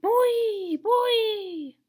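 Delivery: high, loud wailing wind cry; a shrieking wind calling boy; wind crying like a bobcat. A narrator's reading. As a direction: wail the name like a storm wind. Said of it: "crying like a bobcat".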